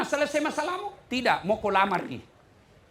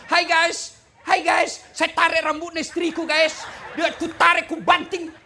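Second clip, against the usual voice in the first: mock vlogger greeting; 5 semitones higher, 4 dB louder than usual. Mock Instagram live of abusive husband.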